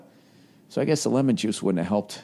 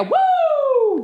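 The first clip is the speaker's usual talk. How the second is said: Ric Flair woo. Acted. Boasting to listeners of his binge, a high Ric Flair woo. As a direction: let out a Ric Flair whoop. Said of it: "like Ric Flair".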